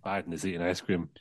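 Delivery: usual speaking voice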